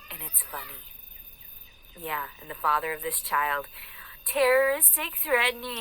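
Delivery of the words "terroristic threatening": high, mocking echo of an accusation; mocking quote. Woman parroting the father's accusation of threats, high and mocking. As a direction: pitch it up and draw it out in mockery.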